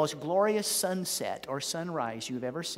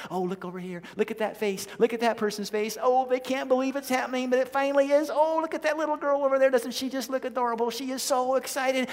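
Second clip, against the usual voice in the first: excited angels; 6 semitones higher than usual. Retold or imitated. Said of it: "hear the angels saying to each other".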